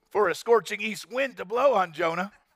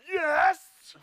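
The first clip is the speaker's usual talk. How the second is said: high sharp mock snap; retort; 12 semitones higher than usual. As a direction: fire it back like a sulky kid. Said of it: "he retorted".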